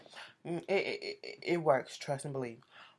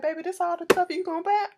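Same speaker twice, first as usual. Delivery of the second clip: higher, louder mock-surprised question; imitating incredulous onlookers; 11 semitones higher, 5 dB louder than usual. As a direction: quote them in a raised, questioning voice. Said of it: "They was like".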